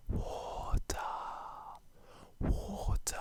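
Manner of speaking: drawn-out whispered word; hushed mock-dramatic reveal. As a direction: whisper it slowly, like a big secret. Whispered reveal of his drink.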